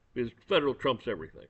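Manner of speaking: usual speaking voice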